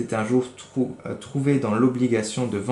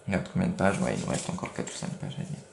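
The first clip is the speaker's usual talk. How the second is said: whispered aside; 4 semitones lower, 7 dB quieter, faster than usual. Real, not acted. Aside to child about pages. (chuchoté en aparté à l'enfant)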